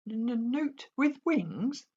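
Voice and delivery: high, slow stammering dragon voice; stuttering little dragon. A narrator's reading. Toothless demands the jokes, high slow stammer. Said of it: "repeated Toothless".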